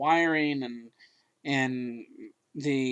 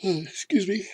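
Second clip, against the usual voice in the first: yawning; 5 semitones higher than usual. Real, not acted. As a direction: excuse me through a yawn. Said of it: "He's yawning".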